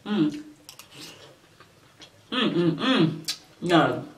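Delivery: ordinary delivery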